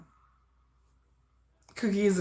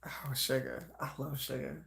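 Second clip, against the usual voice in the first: breathy whispered adoration, much softer; swooning whisper; 5 semitones lower, 15 dB quieter than usual. Real, not acted. Fan whispering her love for her favorite member, softly.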